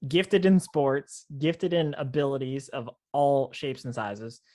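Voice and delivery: drawn out, slow and deliberate; slow emphasis. Real, not acted. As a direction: spell out each gift slowly. Praising Braden's gifts.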